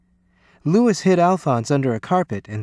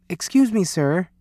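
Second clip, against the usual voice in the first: put-on light, higher-pitched child's voice; timid boy interrupting; 5 semitones higher than usual. A narrator's reading. Lewis interrupting the coach, lightly voiced polite boy. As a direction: pipe up politely, a little high.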